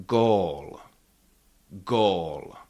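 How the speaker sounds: gól with the ó held long, falling; long O demonstration. Shown as a demonstration. Teacher for learners to repeat, gól with a long O. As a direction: model gól for repeating, stretching the vowel. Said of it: "you can repeat after me".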